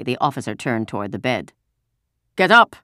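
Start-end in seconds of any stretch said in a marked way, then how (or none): 2.4-2.8 loud, high, abrupt command; angry officer's shout; barked order. A narrator's reading. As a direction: order them out of bed harshly. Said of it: "he ordered".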